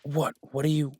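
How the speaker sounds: shocked outburst. Acted. Guest pulls a gun.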